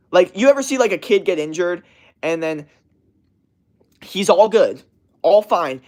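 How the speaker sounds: ordinary talk, nothing marked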